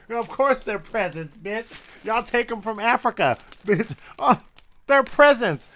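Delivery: loud, biting sarcastic jabs; angry sarcasm. Real, not acted. Ranting at the newspaper, loud angry sarcasm. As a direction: hit each line with scorn.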